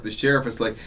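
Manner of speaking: usual speaking voice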